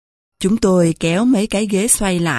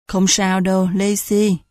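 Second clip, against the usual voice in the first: plain reading voice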